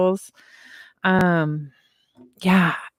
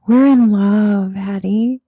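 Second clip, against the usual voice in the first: put-on slow, louder baby talk to a pet; cooing to the cat; 3 semitones higher, 6 dB louder, slower than usual. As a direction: gush over the cat lovingly and slowly. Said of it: "I was like".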